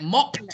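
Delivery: "mopped" said as "mopt"; T-sound ending. Shown as a demonstration. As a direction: release a clear t at the end of "mopped". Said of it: "the sound is a T sound".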